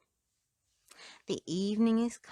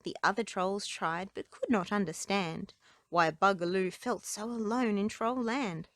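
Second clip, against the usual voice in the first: plain reading voice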